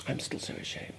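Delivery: breathed confession of shame; ashamed whisper. Real, not acted. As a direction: whisper it, full of regret.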